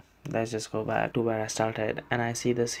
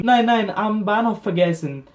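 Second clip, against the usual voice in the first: raised, louder acted correction; higher, insistent retold German reply; flustered correcting; 5 semitones higher, 5 dB louder than usual. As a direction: hurriedly set the clerk straight. Flustered correction to the surprised clerk.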